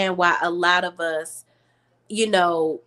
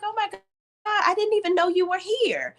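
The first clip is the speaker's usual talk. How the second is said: surprised gushing; 12 semitones higher than usual. Retold or imitated. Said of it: "She was like".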